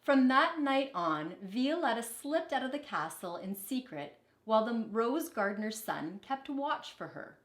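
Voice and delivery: normal talking voice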